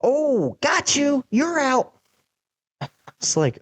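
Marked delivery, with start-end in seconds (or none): 0.0-1.9 high-pitched mock little kid voice; squeaky playground gloat, imitating the kid; dad imitating the kid who hit him, in a high kid voice; kid taunting. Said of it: "And he goes".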